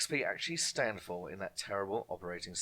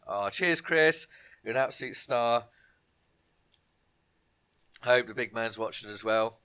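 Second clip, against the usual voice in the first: ordinary delivery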